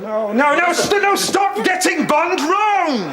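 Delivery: high-pitched shout, building; rising frantic yell, last words stretched out; exasperated outburst. Acted. Bond fan losing it at friends' mistakes, exasperated shouting.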